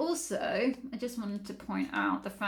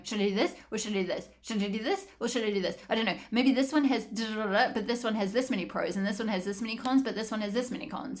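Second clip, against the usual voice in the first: quick back-and-forth indecisive muttering-to-self; mock dithering; 2 semitones higher, faster than usual. Reader acting out an overthinking Gemini, mock dithering. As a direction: rattle through options like a looping mind. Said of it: "this is you up here and up in your head".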